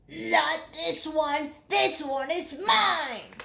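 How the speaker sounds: slow, high defiant frog voice; high, slow stubborn refusal. Acted. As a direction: croak it stubbornly. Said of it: "Milton croaked defiantly".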